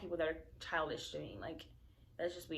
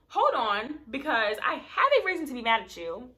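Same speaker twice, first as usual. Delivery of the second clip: angry retort; 5 semitones higher, 8 dB louder than usual. Retold or imitated.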